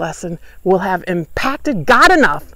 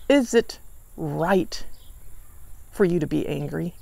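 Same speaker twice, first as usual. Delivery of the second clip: gentle question; 4 semitones lower, 10 dB quieter than usual. Acted. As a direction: ask it quietly, inviting him to reflect. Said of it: "a question of mood".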